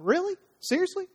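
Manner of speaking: high, disbelieving "really, seriously"; incredulous. Retold or imitated. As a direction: toss it up in pitch like you can't believe it. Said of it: "thought with God going".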